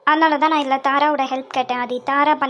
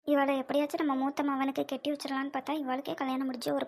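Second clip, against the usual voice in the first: much softer, under her breath; muttered aside; 11 dB quieter than usual. Acted. Visitor muttering her disappointment to herself, very softly. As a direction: grumble it to herself. Woman's disappointed private thought.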